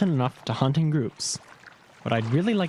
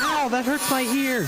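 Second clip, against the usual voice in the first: pained shout; 9 semitones higher than usual. Acted. Complaining about the yelling.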